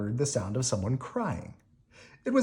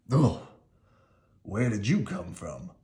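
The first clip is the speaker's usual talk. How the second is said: Lorenzo voice; 3 semitones lower than usual. Acted. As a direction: lower young man, startled grunt.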